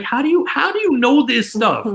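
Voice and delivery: high, marveling question; amazed. Retold or imitated. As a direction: sound blown away by the read. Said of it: "Like, I was like".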